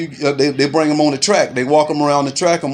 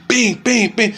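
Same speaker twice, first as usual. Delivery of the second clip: punch sound effects; 7 semitones higher, slower than usual. Retold or imitated. Imitating punches in a fight.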